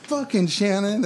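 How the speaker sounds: high, drawn-out teasing cry; playful mock outburst. Drunk friend ribbing Shannon while shoving him, playful mock outburst. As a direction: pitch it way up in silly mock exasperation. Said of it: "to me, it's playful".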